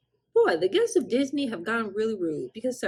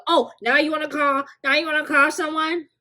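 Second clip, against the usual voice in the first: higher, louder taunt; mocking retort; 8 semitones higher, 6 dB louder than usual. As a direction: sneer at him for calling someone now. Mocking the guest's reaction.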